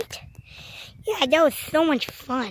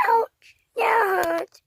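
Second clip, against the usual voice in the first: higher, slow crying complaint; slow, crying, higher ouch; 4 semitones higher, slower than usual. Pretend. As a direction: wail it like a hurt little kid. Pretend hurt toy character.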